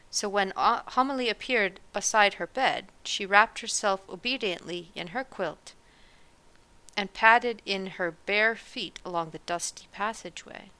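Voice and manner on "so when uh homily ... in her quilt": ordinary delivery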